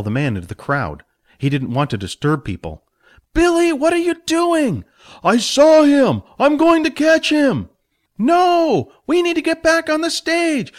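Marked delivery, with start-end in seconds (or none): 3.3-4.8 high, loud alarmed call; alarmed mentor. Acted. Jack calls after Billy. 5.2-7.7 loud, slow, resolute declaration; determined shout. Acted. Billy determined. 8.2-10.7 high urgent protest; protesting mentor. Acted. Jack protests.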